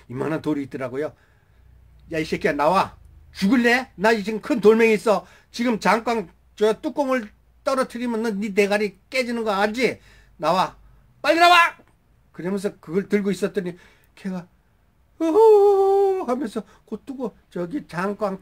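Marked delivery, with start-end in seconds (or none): 2.1-11.6 loud, high threat building to a shouted order; angry threat. Retold or imitated. Soldier threatens a hiding fugitive with a rock, angry shout. 15.2-16.2 long, loud, high mock wail; frightened wail. Cornered fugitive answers from inside the jar, frightened wail.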